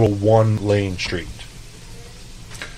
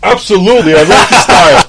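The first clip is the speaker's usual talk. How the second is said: high, loud eager yes, breaking into a laugh; mock gushing to the phone caller; gushing agreement; 11 semitones higher, 14 dB louder than usual. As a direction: oversell the enthusiasm and grin through it. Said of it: "I was like".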